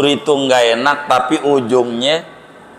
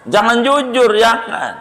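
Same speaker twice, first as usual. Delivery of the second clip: mock warning; 10 semitones higher than usual. Preacher jokes, high mock warning.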